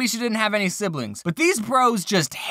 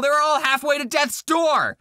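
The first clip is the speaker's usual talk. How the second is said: higher, semi-shouted complaint; mock outrage, called out to the viewers; indignant outburst; 7 semitones higher than usual. Real, not acted. Vlogger complaining about a sale hamster, mock outraged shout.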